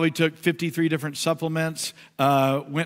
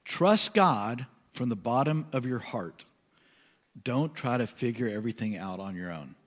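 scripture reading; 4 semitones lower, 4 dB quieter than usual. Acted. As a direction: let each phrase land. Reads Message version.

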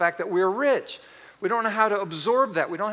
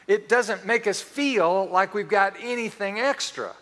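ordinary delivery

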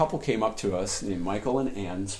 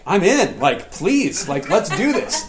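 higher, louder eager plea; eager excitement; 7 semitones higher, 6 dB louder, slower than usual. Retold or imitated. New church member eagerly accepting a mentor's offer, excited. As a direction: jump at the offer, voice up. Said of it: "I was so excited".

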